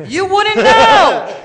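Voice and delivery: indignant. Real, not acted. Indignant comeback, very high, slow.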